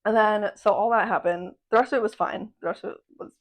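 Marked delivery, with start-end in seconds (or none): 2.6-3.3 whispered. Shown as a demonstration. Pet sitter repeating it to viewers in a whisper. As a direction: whisper it under her breath.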